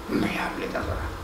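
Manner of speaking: "No me hable de adorar" whispered, voice dropped away; whispered repeat. Shown as a demonstration. Pastor driving his point home in a whisper. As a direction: breathe the line out as a whisper.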